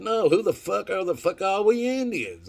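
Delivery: high, loud retold yell of disbelief; mock shout who the heck they are; excited outburst. Joke setup, he blurts out the question in disbelief. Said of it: "I didn't say, "Who the fuck?".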